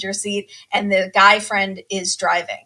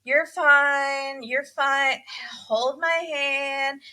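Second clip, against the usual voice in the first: slow, drawn-out bored reassurance; 4 semitones higher, much slower than usual. Retold or imitated. Bored sister talks down the crying bride, mock reassurance. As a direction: imitate someone droning comfort they are tired of giving. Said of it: "the sister is so bored".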